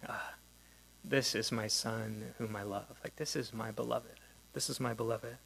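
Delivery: soft, hushed, loving father's words; tender voice of God. Acted. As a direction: speak it gently like a blessing. Voicing the Father's words at baptism.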